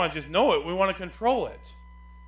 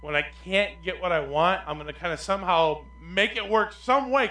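ordinary talk

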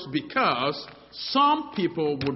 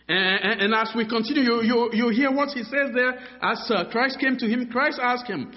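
higher and louder, driving the point home; full-force preaching; 6 semitones higher, 6 dB louder than usual. Acted. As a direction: preach it out to the congregation.

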